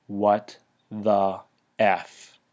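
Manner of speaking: low, drawn out word by word; stunned disbelief. Retold or imitated. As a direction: leave a heavy gap after each word. Stunned reaction to a removed monster.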